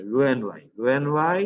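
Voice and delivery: "রু and ওয়াই" repeated, first word sounded roo; correct pronunciation. Shown as a demonstration. Teacher repeating surgery name for student, correct pronunciation "রু and ওয়াই". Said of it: "এটার উচ্চারণ হলো".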